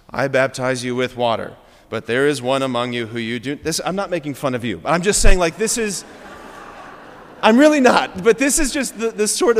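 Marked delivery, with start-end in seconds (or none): 0.1-3.6 low, auto-tuned drone; monotone. Acted. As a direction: recite it on one low note like a robot. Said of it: "the monotone, auto-tune voice".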